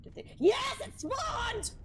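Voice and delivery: sudden high excited yell; excited shout. Real, not acted. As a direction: shout it out in delight. Sudden excited discovery in game.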